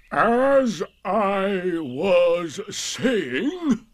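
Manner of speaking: drawn-out stern declamation; loud and stern. A narrator's reading. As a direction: stretch every word loudly to silence interrupters. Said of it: "said Eeyore loudly and sternly".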